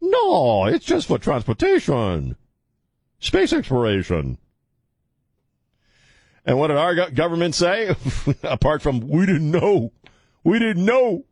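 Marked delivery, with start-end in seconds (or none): 0.0-4.4 cartoon dictator in a mock Chinese accent; Kim Jong-un from Team America. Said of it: "doing the voice of Kim, Kim Jong-un from Team America". 9.1-11.2 high-pitched mocking imitation; whiny-high mock protest; mocking excuse.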